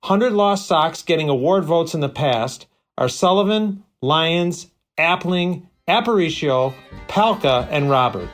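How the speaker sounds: ordinary delivery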